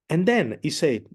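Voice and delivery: ordinary delivery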